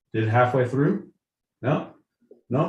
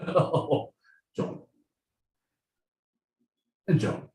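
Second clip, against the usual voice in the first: put-on royal British accent; 5 dB quieter than usual. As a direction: put on a regal British accent, pausing between phrases. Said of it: "in the royal British accent".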